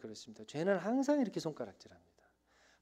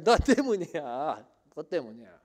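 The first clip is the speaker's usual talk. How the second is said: angry blaming; 4 semitones higher, 9 dB louder than usual. Acted. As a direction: throw the blame at him again. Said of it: "지금 막 소리치는 겁니다". (아브라함에게 따지는 사라, 화난 목소리)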